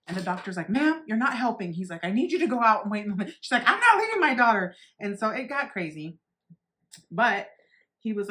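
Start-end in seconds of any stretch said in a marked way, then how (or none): 0.7-1.7 firm raised voice telling off the mom; stern doctor. Retold or imitated. Doctor scolding the panicking mom, raised voice. 2.0-3.3 fast, raised, brisk command; urgent order. Retold or imitated. Doctor sending the mom out to wait, fast and loud. 3.7-4.7 high, loud defiant yell; mom yelling. Retold or imitated. Mom refusing to leave her daughter, high shout.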